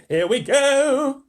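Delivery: high, loud, very slow cry; excited shout. A narrator's reading. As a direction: whoop it out hopping with excitement. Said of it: "shouted the old green grasshopper".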